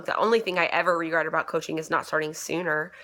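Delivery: ordinary delivery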